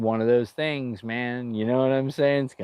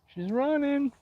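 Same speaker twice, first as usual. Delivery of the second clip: very high sing-song falsetto echo; mock-squeaky playful repeat; slower than usual. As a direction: jump way up into a silly high voice.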